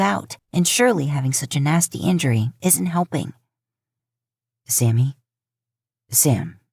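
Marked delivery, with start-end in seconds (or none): 4.7-6.6 low and careful, testing if it's really him; Dean, cautious. Acted. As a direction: lower voice, a wary, gentle call to his brother. Dean voiced gently to moose brother.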